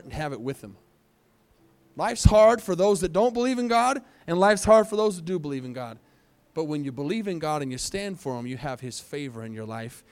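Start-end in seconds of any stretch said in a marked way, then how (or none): 1.9-6.0 loud, high sermon shout; booming preacher; preaching peak. Acted. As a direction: hammer both halves home.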